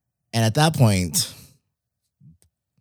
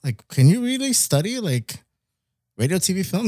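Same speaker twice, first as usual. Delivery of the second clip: eager disbelief; 7 semitones higher than usual. Retold or imitated. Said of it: "I was like".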